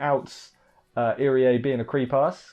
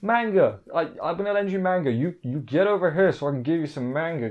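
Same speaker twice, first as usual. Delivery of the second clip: flustered nervous deflecting, higher and rushed; nervous dodge; 5 semitones higher than usual. Retold or imitated. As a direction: panicky change of subject, much higher. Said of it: "nervous eye look".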